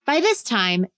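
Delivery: plain reading voice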